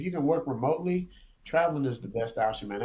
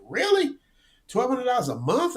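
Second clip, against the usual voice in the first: much higher, incredulous echo; surprised disbelief; 9 semitones higher, 3 dB louder than usual. Retold or imitated. Retelling his shock at the friend's cheap Thailand rent, high and incredulous. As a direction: repeat the number back in stunned disbelief.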